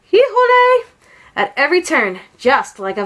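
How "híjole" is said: high, loud cowboy whoop; a wild vaquero whoop; shout. A narrator's reading. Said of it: "and shouting".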